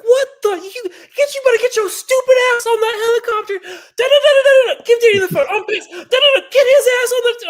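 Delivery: very high, loud shrieking rant; imitating a mother yelling in squeaky outrage; furious mom. Angry mother on phone ordering him onto helicopter, shrieking.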